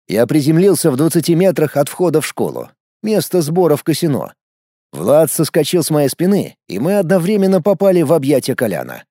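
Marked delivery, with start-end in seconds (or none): none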